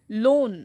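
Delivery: a loud, held লোন by itself; stress on লোন. Shown as a demonstration. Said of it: "বেশি stress".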